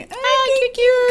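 high, slow cooing voice; mock cutesy gushing. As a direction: gush over something tiny. Retelling cooing at mini cokes.

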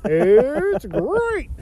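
drawn-out catchphrase, voice unlike their own; mock cereal mascot. Friend echoing the Tony catchphrase for laughs, mock mascot. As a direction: do a slow, stretched cartoon tiger. Second take of Tony impression.

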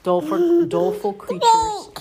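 high, loud, drawn-out doleful creatures; milk the word doleful like a storyteller; dramatic reading. Acted.

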